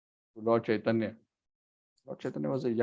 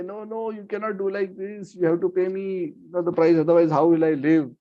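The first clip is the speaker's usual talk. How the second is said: higher-pitched mock pleading; pleading protest; 4 semitones higher than usual. Seller to Lord walking off with bananas, pleading protest.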